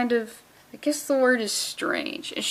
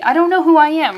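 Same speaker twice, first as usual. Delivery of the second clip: mock identity crisis; 6 semitones higher, 9 dB louder than usual. Real, not acted. Identity crisis over liking Jubilee.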